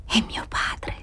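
whispered, stifled fear; a frightened whisper to her lover; murmured in fear. A narrator's reading. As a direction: breathe it out, afraid to be heard. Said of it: "mormorò poi con una paura soffocata".

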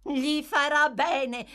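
high, loud, slow pronouncement; approving. A narrator's reading. Two women approve the boy's beating, emphatically. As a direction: pronounce it with drawn-out relish.

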